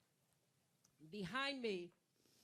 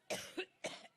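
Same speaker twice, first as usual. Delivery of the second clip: deep hacking cough from the chest; chesty cough. Real, not acted. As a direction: cough it out hard. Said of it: "I get allergic".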